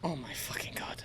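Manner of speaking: breathless whispered oh my God; a stunned, disgusted whisper at the clip; whispered disbelief. Real, not acted. Reacting to clip.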